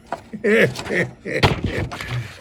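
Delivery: long, high chuckle; drawn-out high giggle; laughing at own joke. Real, not acted. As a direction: laugh on and on at your own gag.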